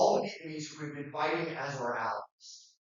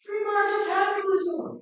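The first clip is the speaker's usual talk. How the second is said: much higher, mock-triumphant cry of "Free market capitalism!"; mocking British cheer. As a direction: cheer it like a smug imperial official. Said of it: "the British are like".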